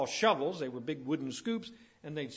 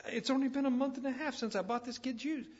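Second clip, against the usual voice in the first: mildly complaining, pitched higher; complaining parent; 7 semitones higher, 3 dB quieter than usual. Retold or imitated. Parent complains about buying kid's shoes, mock higher-pitched complaint. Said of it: "I've heard parents complain again and again".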